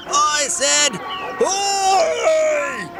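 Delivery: high gnome voice, shouting; growled shout. Acted. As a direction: bellow it again, getting impatient. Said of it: "he growled".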